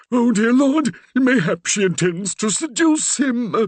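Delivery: put-on the old man's alarm running away with him; rising panic. A narrator's reading. The Reverend panicking to Percy about his daughter, rising alarm. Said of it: "his voice rising in panic".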